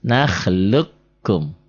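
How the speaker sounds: very slow "Nakhluqkum", q dropped into a long doubled k; silent merged q. Shown as a demonstration. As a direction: recite "Nakhluqkum" as nakhluk-kum, holding the join. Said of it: "huruf matinya jangan dibaca".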